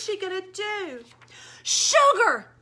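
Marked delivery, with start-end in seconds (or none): 1.6-2.5 loud, higher command; commanding shout. Acted. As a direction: call it out like an order. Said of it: "I commanded".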